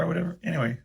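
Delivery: ordinary delivery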